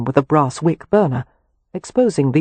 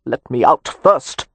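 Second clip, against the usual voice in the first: muffled; 3 semitones lower, slower than usual. A narrator's reading. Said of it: "came the muffled reply".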